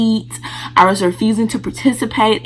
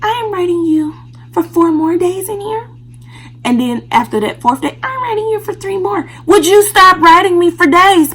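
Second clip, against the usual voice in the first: much higher mocking doctor voice; 11 semitones higher, 4 dB louder than usual. Doctor extending a patient's stay, mock imitation. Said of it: "She was like".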